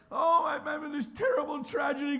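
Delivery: high, loud fake wail; mock Gen Z drama. Old dude mocking Gen Z livestreamers, melodramatic fake wail. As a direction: ham up a tragedy for the phone. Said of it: "modern Gen Z people on their phone".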